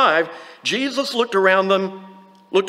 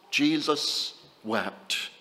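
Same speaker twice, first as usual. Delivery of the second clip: softer, lower, lingering on each word; hushed and solemn; 3 semitones lower, 8 dB quieter, much slower than usual. Acted. Preacher reading the verse to the congregation, hushed and solemn. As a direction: let the two words sink in reverently. Quoting the famous two words.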